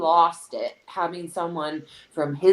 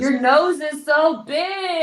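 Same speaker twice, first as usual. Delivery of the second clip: high, slow mocking shout; mocking hater voice; 11 semitones higher, 4 dB louder, slower than usual. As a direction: shout the insult like a troll.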